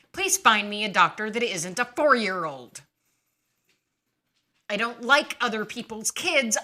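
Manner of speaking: loud, indignant rant; fed-up outburst. Retold or imitated. Furious complaint to her surgeon.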